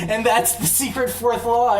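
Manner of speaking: laughing, high and loud. Real, not acted. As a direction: laugh through the punchline. Laughs at own joke.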